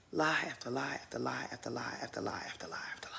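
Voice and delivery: hushed, breathy litany of lies; whisper. Pretend. Vlogger listing Mia's endless lies, whispered. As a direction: whisper it like gossip.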